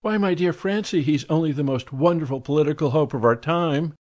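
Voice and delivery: high, gushy society lady; effusive high society lady; gushing socialite. A narrator's reading. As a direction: swoon over the marvelous man.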